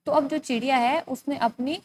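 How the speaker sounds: normal talking voice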